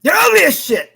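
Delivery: high, loud mocking yell; mock shout. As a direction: bellow the insult. Mocking the quoted insult.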